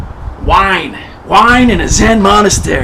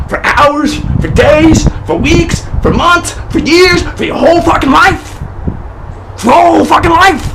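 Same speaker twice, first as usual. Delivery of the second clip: building rant; 7 semitones higher than usual. Acted. Ranting at the monks.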